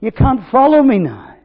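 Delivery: loud, high proclaiming of Jesus' words. Acted. Preacher voicing Jesus telling disciples he leaves, proclaimed. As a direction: cry out the Lord's parting words.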